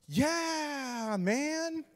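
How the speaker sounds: high, soft, drawn-out fib; badly faked casual reassurance; guilty lie. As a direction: stretch it out, squeaky and unconvincing. Lying to angry roommate.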